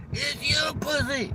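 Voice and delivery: very high, soft and slow woman voice; her voice. Retold or imitated. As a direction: breathe her answer back softly and slowly.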